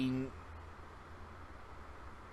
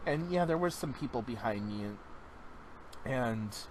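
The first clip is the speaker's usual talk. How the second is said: normal talking voice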